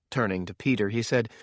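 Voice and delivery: plain reading voice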